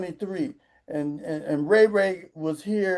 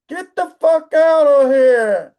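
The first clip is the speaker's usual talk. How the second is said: loud, slow, raised-pitch dismissal; indignant comic outburst; angry dismissal; 5 semitones higher, 9 dB louder, slower than usual. Acted. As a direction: throw them out, stretching the words loudly. Angry dismissal in his rant.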